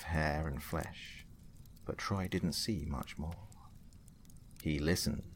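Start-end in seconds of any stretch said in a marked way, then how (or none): none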